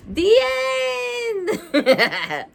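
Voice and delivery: a gasp, then a high cheering The end; excited shout. Real, not acted. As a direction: shout the last words out, thrilled. Triumphant finish of the book.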